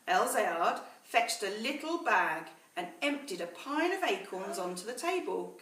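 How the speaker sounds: put-on slow, measured storytelling to children; story narration. A narrator's reading. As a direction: read it like a bedtime story to the class. Teacher reads story to class.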